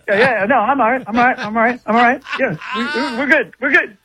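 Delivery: high-pitched impression of a twitchy character; mock-jittery, repetitive reassurance; Bubbles. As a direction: imitate someone nervously insisting all is well. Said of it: "that's who he sounded like".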